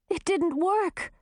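high, slow, dismayed cry. A narrator's reading. Grisina over Haywood, crying out in dismay. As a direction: let the disappointment break through. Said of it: "cried Grisina".

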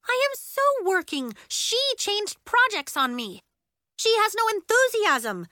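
high, loud, indignant bickering; squabbling children. A narrator's reading. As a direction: argue back and plead to the teacher, high-pitched and heated. Girls quarrel before their teacher.

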